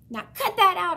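a little hamster squeaking back; squeaked. A narrator's reading. Hamster squeaks at the barking dog to stop. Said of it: "I squeaked at him".

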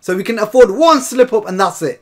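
ordinary delivery

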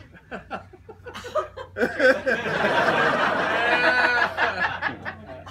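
long high laugh, fading down; laughing hard at his own joke. Real, not acted. Laugh after engaged joke.

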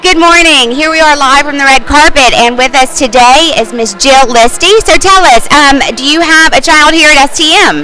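upbeat live TV host; red carpet host. Acted. Live broadcast interview.

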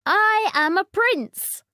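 high, loud announcement; triumphant young prince; proud prince. A narrator's reading. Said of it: "said the boy".

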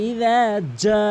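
ইদা যা with যা held very long; long drawn ja. Shown as a demonstration. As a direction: stretch যা four beats after ইদা. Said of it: "চার আলিফ টেনে পড়তে হবে".

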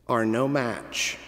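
ordinary delivery

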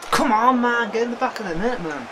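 high, loud urging shout at the screen; frustrated yell. Real, not acted. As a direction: scream him into the net. Yelling at striker to score.

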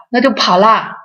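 ordinary talk

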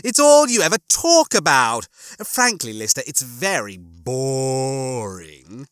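loud complaint slowing into a drawl; Rimmer. Acted. Rimmer finds Lister's talk tedious, exasperated and drawling.

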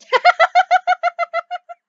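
loud squealing laugh; high burst of laughter. Real, not acted. Laugh after being killed.

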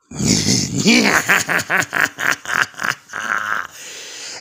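long gleeful goblin cackle; evil cackle. Acted. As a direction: cackle like a madman. Goblin villain laughing.

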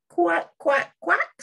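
high little duckling quacks. Acted. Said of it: "say the ducklings".

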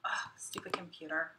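whispered under her breath at the computer; annoyed whisper. Real, not acted. Live host whispering at her broken computer. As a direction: hiss it low, a real aside.

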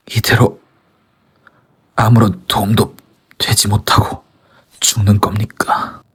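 dying whisper. Acted. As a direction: whisper with the last breath. Dying soldier's last words.

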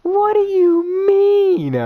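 slow high loud mock-sobbing whine; crying wail. Real, not acted. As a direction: wail it slowly like you're crying. Despair at game.

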